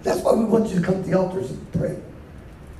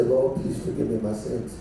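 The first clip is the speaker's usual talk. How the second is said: humble prayer; 10 semitones lower, 6 dB quieter than usual. Pretend.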